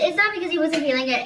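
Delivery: ordinary delivery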